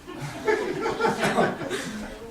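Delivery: a big airy laugh bursting out; breathy delighted laugh; loud burst of laughter. Acted. Laughter at the confession.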